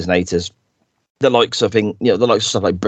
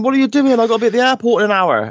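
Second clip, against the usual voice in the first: high, rushed alarmed cry; panicked daughter; 14 semitones higher, faster than usual. Retold or imitated. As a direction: stepdaughter stranded on the boat, fast panicked pitch. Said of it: "And she's going".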